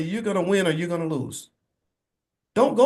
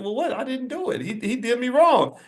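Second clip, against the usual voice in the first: put-on higher, indignant kid voice; childish protest; 10 semitones higher than usual. Boy protesting to his mother that his friend did him wrong, high and indignant. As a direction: protest like a wronged nine-year-old, pitched up. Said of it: "And I said".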